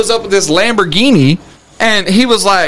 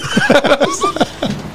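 a sudden squealing laugh, way up high; high burst of laughter; 5 dB quieter than usual. Real, not acted. Laughing at his near-death bike story, a high squealing laugh. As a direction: let a high helpless laugh escape.

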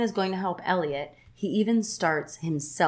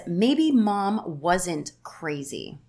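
slow, haunted; much slower than usual. Retold or imitated. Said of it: "she says".

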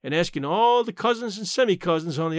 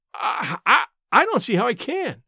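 stammering, higher, breathless; gasped; 6 semitones higher, 3 dB louder than usual. A narrator's reading. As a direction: stumble over it, caught off guard. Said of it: "I gasped".

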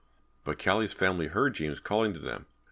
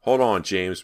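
loud, higher urgent call; raised voice, mildly breathless; 4 semitones higher, 8 dB louder, slower than usual. Acted. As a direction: call out mid-effort to a child. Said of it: "Brant called breathlessly".

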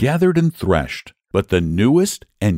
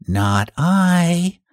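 much higher cat voice, offhand; idle cat; 8 semitones higher than usual. Acted. Cat refusing the hen, high offhand voice. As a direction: toss it off like a bored cat. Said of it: "And the cat said".

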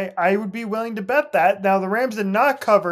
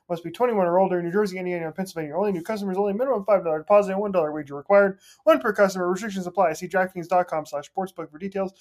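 rapid, rattled-off fine print; fast legal disclaimer; faster than usual. Acted. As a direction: race through the terms. Legal terms at end of ad.

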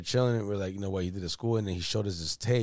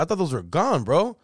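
high, imitated agitation; agitated protest; 7 semitones higher, 5 dB louder than usual. Brother thought his tapes were lost, imitated agitation. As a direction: protest with the voice climbing. Caller upset over tapes, acted.

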